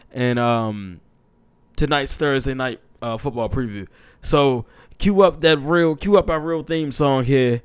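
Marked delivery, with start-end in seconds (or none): 4.2-7.6 loud, pumped call to start; loud hype to cue the theme. Real, not acted. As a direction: fire up the crowd. Calling for the theme song.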